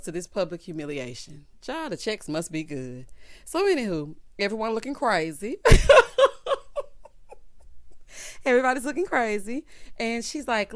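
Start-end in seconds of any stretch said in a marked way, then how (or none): 5.6-8.4 high, squealing laughing fit; real wheezing laughter, loud and long; loud cackle. Real, not acted. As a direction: burst out laughing helplessly. Cracks up at crazy looks.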